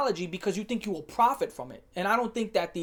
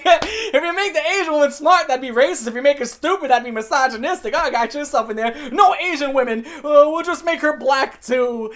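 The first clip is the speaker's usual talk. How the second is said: loud, high, laughing through the words; laughing mockery; 7 semitones higher, 9 dB louder than usual. Real, not acted. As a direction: crack up while mocking their logic. Cracks up mocking the corporate logic.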